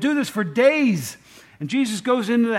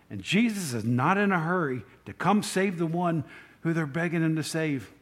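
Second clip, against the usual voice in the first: ordinary talk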